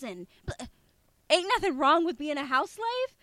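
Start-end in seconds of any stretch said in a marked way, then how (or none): 1.3-3.2 imitated Southern accent. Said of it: "in an accent".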